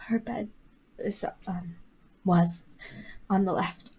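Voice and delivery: very soft, faltering words; grieving, hushed. Acted. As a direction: let the voice sink as she corrects herself. Mother speaks of dead daughter.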